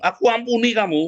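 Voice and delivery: high, pressed "aku ampuni kamu"; fed-up forgiveness. Shown as a demonstration. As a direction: spit out the pardon after venting. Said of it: "Kamu lagi jengkel".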